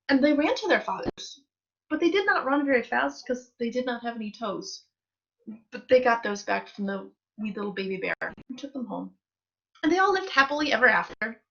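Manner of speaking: ordinary delivery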